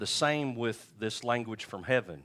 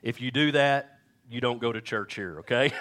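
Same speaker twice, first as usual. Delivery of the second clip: ordinary talk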